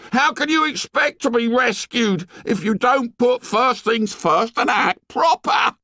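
put-on very high, scolding boy's rant; indignant tirade. A narrator's reading. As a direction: scold them in a high, heated voice. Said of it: "listening to the tirade".

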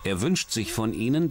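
ordinary delivery

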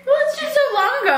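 laughing it off. Real, not acted. Laughing off a surprising reveal.

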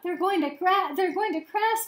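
rising alarm at the crash; alarmed gasp. A narrator's reading. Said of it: "gasped Stella Luna".

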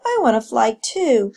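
put-on high, drawn-out child's plea; eager youngster begging mother; pleading cry. A narrator's reading. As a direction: whine it upward, stretching the words. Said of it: "cried Echo".

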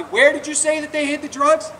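pitched high and loud for others to hear; a cop loudly staging a question for bystanders; said real loudly. Retold or imitated. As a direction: call it out so the whole street hears. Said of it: "he said real loudly".

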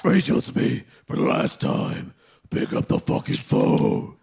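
deep Batman growl. Acted. Batman growling on Rachel's voicemail, low and rasping. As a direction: growl it like a brooding vigilante.

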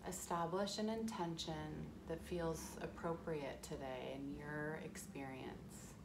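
calm, unhurried guiding into the breath; guided meditation. Acted.

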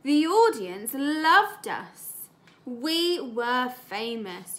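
slow, drawn-out storybook voice; story reading. Acted. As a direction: savour each line for the class. Teacher reads story aloud.

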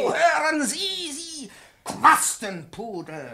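high, loud yelled insult; furious shout. Acted. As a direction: bellow the insult at her. Professor yells at the pupil.

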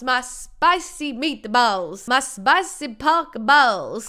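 very slow, drawn-out relish; hammed-up waiter. Waiter offering the table a sample, hammed-up. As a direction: stretch every word suggestively for laughs.

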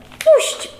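very high, choked cry to let go; desperate shriek. A narrator's reading. Pyza shrieking at Bernard to let her go, panicked high cry. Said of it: "zduszony wrzask".

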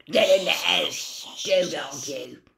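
hissed aside. Acted. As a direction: snarl it to his son. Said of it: "out of the corner of his mouth".

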